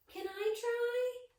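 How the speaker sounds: put-on high, slow little-kid ask; small child voice. Reader voicing a child asking to try, high and slow. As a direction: pipe up like a shy small child.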